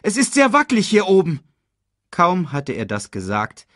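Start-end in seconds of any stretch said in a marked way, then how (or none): 0.0-1.4 fast, high, loud caution; anxious young voice, rushed and raised; urgent warning. A narrator's reading. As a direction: blurt the warning hurriedly. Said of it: "warnte Simba Nala".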